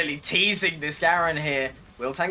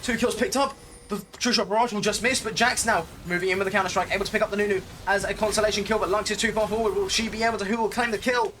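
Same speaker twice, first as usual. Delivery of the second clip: excited; 5 semitones higher, faster than usual. Real, not acted. Teamfight erupts in live casting.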